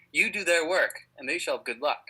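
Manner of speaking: normal talking voice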